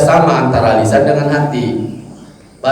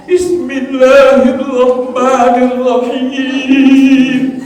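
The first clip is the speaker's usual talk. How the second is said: high, slow, tearful chanted recitation; fake-weep through a drawn-out recitation; pretend crying; 8 semitones higher, much slower than usual. Preacher showing how to fake tears in prayer, pretend crying. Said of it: "kayak nangis".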